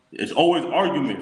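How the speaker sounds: usual speaking voice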